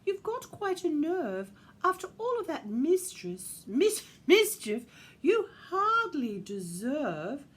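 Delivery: loud, high scolding; indignant Missus Claus. A narrator's reading. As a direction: scold in a put-on, affronted voice. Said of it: "in her slightly strange accent".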